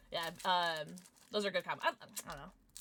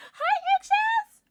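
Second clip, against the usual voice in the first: very high, loud, drawn-out hello; piercingly high excited greeting; shrieked greeting; 13 dB louder, slower than usual. Pretend. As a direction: squeal the viewer's name slowly.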